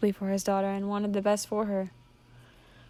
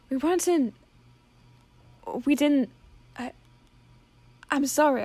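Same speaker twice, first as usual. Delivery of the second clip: halting apology; 6 semitones higher than usual. Acted. Father stammers apology.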